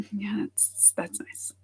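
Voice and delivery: very soft, half-whispered brush-off; unbothered. Acted. Reply to being called stupid, acted quiet indifference. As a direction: murmur it under your breath, not taking it on.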